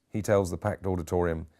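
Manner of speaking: ordinary delivery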